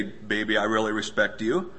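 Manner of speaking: lightly acted low card message; a hint of low, soft wooing; mock sweet talk. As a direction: drop the pitch for a mock endearment. Mock card from wife to husband.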